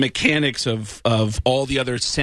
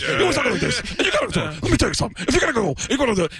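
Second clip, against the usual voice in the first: higher, fast, pushy comic rant; higher, fast loudmouth comic impression; Bobby Slayton; 7 semitones higher, faster than usual. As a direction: rattle it off like a brash comedian. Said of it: "like Bobby Slayton".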